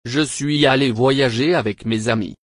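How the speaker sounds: ordinary talk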